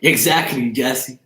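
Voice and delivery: normal talking voice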